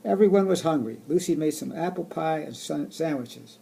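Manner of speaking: storybook reading voice; reading to children. Acted. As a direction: read it aloud to kids. Reading picture book aloud.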